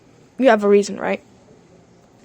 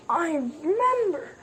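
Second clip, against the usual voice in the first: ordinary talk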